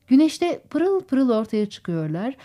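ordinary delivery, nothing marked

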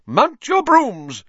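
high, slow, loud commanding call; stern referee. A narrator's reading. Madam Hooch to the players, slow commanding call. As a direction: draw out each word as an order. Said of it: "said Madam Hooch".

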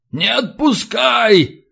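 high, slow, drawn-out plea; pleading prisoner. A narrator's reading. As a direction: stretch out a frightened cry to be held. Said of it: "снова подал голос Катран". (протяжная испуганная мольба)

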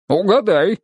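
high, sing-song challenge; teasing. A narrator's reading. Goga invites friend to guess his job, teasing. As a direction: dangle a riddle playfully.

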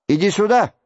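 high call from the window; acted shout. A narrator's reading. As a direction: call someone over from across the room, pitched high. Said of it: "закричал от окна".

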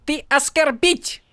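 high, slow accusation; mock Horneater accent. A narrator's reading. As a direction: jab an accusing finger. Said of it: "объявила Шалан".

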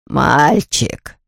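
long drawn-out, creepy wheeze; scary hoarse voice. A narrator's reading. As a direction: wheeze it like a sinister stranger. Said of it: "просипел сзади страшным голосом незнакомец". (сипло, страшным голосом)

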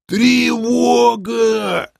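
high, long-drawn alarm cry; strained alarm yell. A narrator's reading. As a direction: wail the alarm with the word stretched right out. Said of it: "надрывно завопили откуда-то снизу". (надрывный протяжный вопль тревоги)

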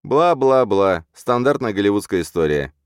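bored mocking. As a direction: drag it out mockingly. Bored mockery of villain speech. (насмешливо-скучающе)

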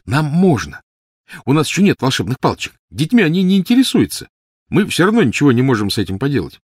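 put-on high, brisk child's voice; boy Snape, reassuring. A narrator's reading. Snape assuring Lily they are allowed, confident.

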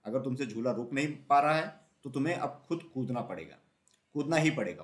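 usual speaking voice

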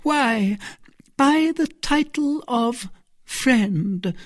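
high, slow, hesitant answer; flustered, drawn-out high reply; embarrassed. A narrator's reading. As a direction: grope for an innocent excuse.

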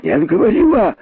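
high-pitched heated contradiction; squawked protest; indignant cry. Acted. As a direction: cry out in outraged denial. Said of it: "закричал находчивый".

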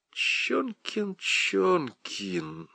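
soft, drawn-out feigned remembering; a hint of theatrical pondering; mock recalling. A narrator's reading. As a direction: pretend to search your memory, quietly. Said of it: "как бы мучительно припоминая".

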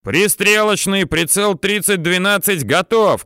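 high-pitched readiness callout; shouted report. A narrator's reading. Gunner reporting ready to commander, high shouted report. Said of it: "кричит наводчик".

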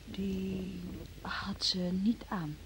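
slow guilty whisper. Acted. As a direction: let it slip out under breath. Hesitant admission about the coat. (traag en fluisterend)

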